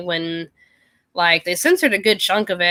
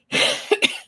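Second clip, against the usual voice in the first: dry throat cough; coughing on dust. Real, not acted. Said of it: "Sorry, it just feels like there's, like, dust in my throat".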